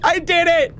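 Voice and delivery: high, jubilant cry; elated victory squeal; triumphant. Real, not acted. Celebrates getting through.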